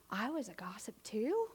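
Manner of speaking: hushed, scandalised whisper; whispered confession. Acted. Preacher admitting she gossiped too, shocked whisper. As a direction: whisper it like a shameful discovery.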